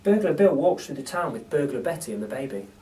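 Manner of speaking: ordinary delivery, nothing marked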